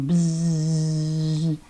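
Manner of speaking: low droning buzz like a bee; a kids' teacher doing a bee buzz. Pretend. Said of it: "We're like bees buzzing".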